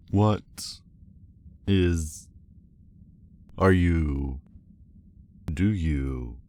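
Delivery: awkward false starts; halting, searching for words. Acted. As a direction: stop and start, fumbling the question. Awkwardly fumbling a question.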